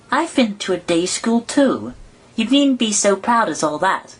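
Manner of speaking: put-on high, girlish and a bit indignant; high girl's voice, slightly offended; Alice, piqued. A narrator's reading. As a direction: put him in his place, like a proud schoolgirl. Alice answers proudly.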